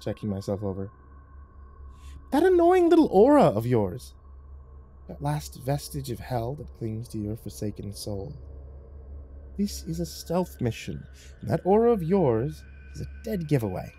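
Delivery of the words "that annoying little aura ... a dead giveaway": high, theatrical, sly voice; taunting devil cat. Acted. Lucifer explaining a stealth mission, high and mocking. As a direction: needle him with lofty disdain.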